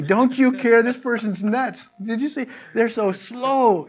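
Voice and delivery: high, loud, exasperated nagging; an irritated shopper demanding sympathy, high and loud; exasperated complaint. Retold or imitated. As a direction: whine it at them, pitched high and loud, dragging the last words slowly. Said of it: "they'll look at you and they say".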